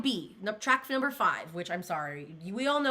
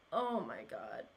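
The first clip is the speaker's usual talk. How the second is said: low dismay; 11 semitones lower, 8 dB quieter than usual. Real, not acted. Reacting to sad track title.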